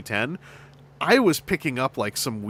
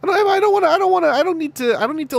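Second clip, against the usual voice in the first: high, loud, giddy rush; excited squeal; 14 semitones higher, 5 dB louder, faster than usual. Real, not acted. Podcast host gushing over a toy, excited squeal. Excited rant about gripping.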